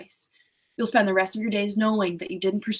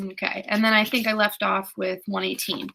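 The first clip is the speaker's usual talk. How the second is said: ordinary delivery, nothing marked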